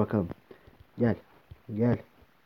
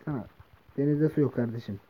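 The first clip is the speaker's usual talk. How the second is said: ordinary delivery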